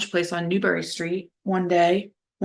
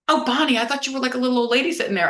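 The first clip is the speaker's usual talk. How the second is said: a retold friend's surprise, said higher; half-mock surprised greeting, pitched up; 6 semitones higher, faster than usual. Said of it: "And she goes".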